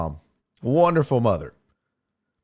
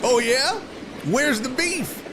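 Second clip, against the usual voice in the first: high-pitched mock challenge; imitating mom's comeback; 9 semitones higher than usual. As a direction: lightly play mom firing back a slogan. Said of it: "waiting for her to be like".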